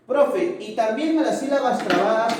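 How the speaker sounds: a hint of a keen pupil asking; imagined student.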